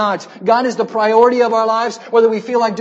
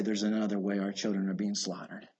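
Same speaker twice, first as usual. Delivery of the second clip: much lower and softer, gentle pastoral tone; loving and careful; 12 semitones lower, 14 dB quieter than usual. Real, not acted. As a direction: ease down, say it tenderly. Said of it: "may I so lovingly, carefully say".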